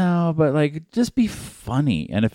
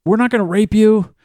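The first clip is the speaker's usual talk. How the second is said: mock movie line; 10 semitones higher, 3 dB louder than usual. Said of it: "They're like".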